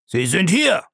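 high-pitched exclamation; calling out. A narrator's reading. Bellamy revealing his captives to the archer, crying out. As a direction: cry it out in triumph. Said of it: "rief Bellamy".

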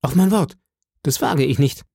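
high, pleading refusal; frightened boy. A narrator's reading. Scared boy refusing the blind beggar, high and pleading. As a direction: beg off in a scared rush. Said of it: "rief ich".

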